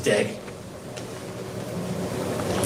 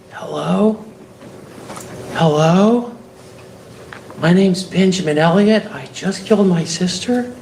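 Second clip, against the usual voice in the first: mock caller; 5 semitones higher than usual. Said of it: "Ben was whispering or that he said".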